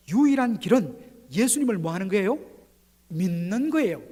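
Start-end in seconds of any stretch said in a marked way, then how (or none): none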